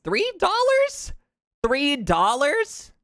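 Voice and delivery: very high, drawn-out disbelief; slow squeal of disbelief at the price; incredulous. Real, not acted. Streamer balking at a pricey donut, in high-pitched disbelief.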